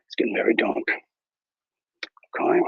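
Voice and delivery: normal talking voice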